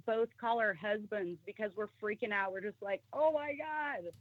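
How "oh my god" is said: high, slow, drawn-out alarm; freaked-out exclaim. Retold or imitated. Calling husbands from the parking lot, freaked out: high drawn-out alarm. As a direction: stretch it out, spooked.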